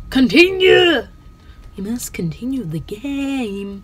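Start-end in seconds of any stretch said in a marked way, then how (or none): 0.1-1.1 loud high command; shouting. Real, not acted. Gamer yelling at the frozen game to continue. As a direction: yell it at the stuck game.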